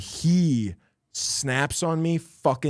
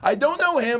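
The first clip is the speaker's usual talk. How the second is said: furious yell. Retold or imitated. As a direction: blast "I don't know him" at him the second time. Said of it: "I was so furious".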